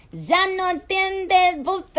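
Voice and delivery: high, slow, exaggerated Spanish accent. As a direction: play up a Spanish accent, drawn out. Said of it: "Do the Spanish accent".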